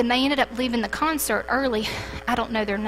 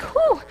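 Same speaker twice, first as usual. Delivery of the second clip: relieved whew; 3 dB louder than usual. Retold or imitated. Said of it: "I'm like".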